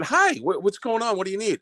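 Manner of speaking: high-pitched, nervous greeting; high, stumbling, too-innocent questions; flustered girlfriend. Retold or imitated. Voices girlfriend startled at the door.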